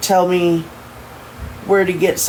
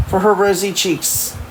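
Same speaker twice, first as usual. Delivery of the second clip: ordinary delivery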